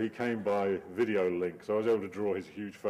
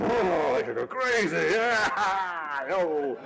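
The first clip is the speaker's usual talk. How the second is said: mock crowd frenzy; 9 semitones higher, 7 dB louder, much slower than usual. Crowd going crazy for Palin.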